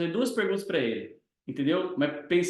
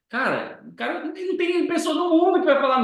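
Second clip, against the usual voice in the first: emphatic; 11 semitones higher, 6 dB louder than usual. Real, not acted. Mentor stresses his point.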